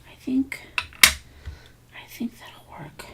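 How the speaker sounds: unvoiced whisper to herself; whispering while concentrating on lettering. Real, not acted. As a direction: hush it, barely audible. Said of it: "Why am I whispering?".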